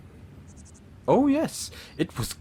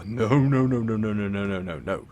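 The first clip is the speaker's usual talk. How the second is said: emphatic denial; 4 dB louder, faster than usual. Acted. Villain denying it was simple renovation, emphatic. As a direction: run of noes tumbling downward.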